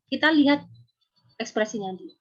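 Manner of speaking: ordinary talk, nothing marked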